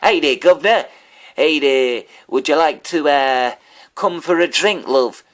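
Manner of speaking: high, loud, badly faked British; hammed-up awful British accent, calling out to girls; horrible British accent. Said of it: "absolutely fucking horrible at British accents".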